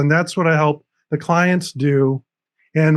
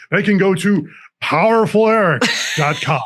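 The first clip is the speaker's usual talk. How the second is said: booming mock-heroic announcement; big hammed-up superhero proclamation; 3 semitones higher than usual. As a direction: play the superhero, drawing out the name grandly. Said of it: "I'll say it in my superhero voice".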